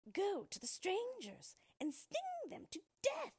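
witch crying out. Acted. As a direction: shriek the order like a wicked witch. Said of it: "she cried".

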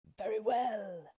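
slow beastly agreement; wolves replying. Acted. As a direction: answer like a pack of wolves. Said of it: "replied the wolves".

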